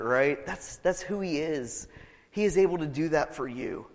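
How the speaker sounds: ordinary delivery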